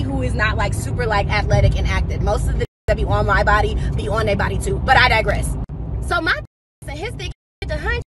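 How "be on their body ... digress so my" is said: ordinary delivery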